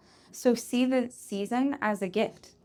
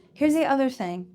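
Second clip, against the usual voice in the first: normal talking voice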